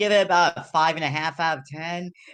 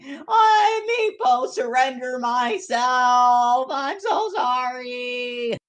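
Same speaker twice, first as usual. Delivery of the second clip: high, loud, slow desperate surrender cry; loud groveling; 4 semitones higher, 5 dB louder, much slower than usual. Acted. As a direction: beg at the top of your voice, dragging every word. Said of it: "isn't very good at quiet groveling".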